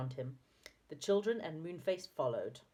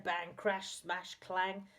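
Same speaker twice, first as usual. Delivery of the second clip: ordinary delivery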